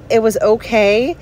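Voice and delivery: ordinary delivery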